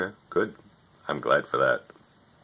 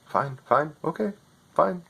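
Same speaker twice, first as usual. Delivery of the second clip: higher, scripted helpdesk patter; overseas tech support voice; 6 semitones higher than usual. Retold or imitated. Overseas agent reading his script to a customer, lightly mocking. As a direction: read the script like a bored call-centre agent. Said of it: "You're not even in America".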